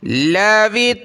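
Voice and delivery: slow, melodic tilawat; slow measured recitation; Quran recitation. Shown as a demonstration. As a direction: chant the verse drawn out. Full verse recitation.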